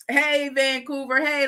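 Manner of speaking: normal talking voice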